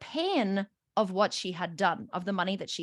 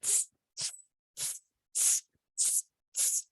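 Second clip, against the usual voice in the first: short bursts of "tss"; imitated sizzle of being triggered; repeated hissing; 6 dB quieter, slower than usual. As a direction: hiss out little jets of steam. Imitates being triggered, discharging pain.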